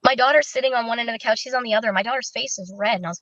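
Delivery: usual speaking voice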